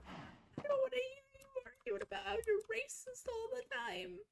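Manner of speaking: high whiny mocking imitation of the roommate; mocking the girl. Mocking the screaming roommate, high and whiny. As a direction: whine it high like the girl did. Voicing girl's screaming arguments.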